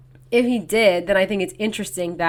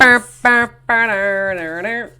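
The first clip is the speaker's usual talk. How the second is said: silly drumroll noise; 7 dB louder, slower than usual. Pretend. Silly sound before topic.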